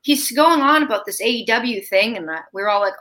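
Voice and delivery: ordinary delivery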